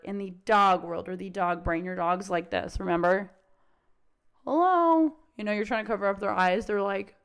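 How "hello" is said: higher, louder hello; dog calling out. Retold or imitated. Dog calling past its owner, higher and louder. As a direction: call out like the distracted dog.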